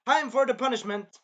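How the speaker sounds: high, loud mock proclamation; hammed-up royal declaration; punishment announcement. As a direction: proclaim it like a queen passing judgment. Acts queen announcing punishment.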